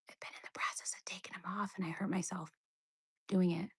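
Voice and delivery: fast whispered confession. Real, not acted. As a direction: hush it like an embarrassed aside. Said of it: "whispered confession about nails".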